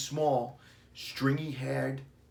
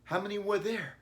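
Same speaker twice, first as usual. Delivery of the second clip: put-on anxious boy; 10 semitones higher than usual. A narrator's reading. Billy worried question.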